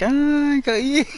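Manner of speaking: high, loud gleeful gotcha; gleeful triumph. Acted. Snatching the toy from the dog, loud high gleeful gotcha. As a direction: crow with glee as you snatch it.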